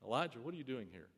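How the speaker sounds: a soft, near hush, quick; barely above a breath; quiet voice of God. Retold or imitated. God gently asking Elijah again, a soft quick hush. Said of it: "that quiet voice".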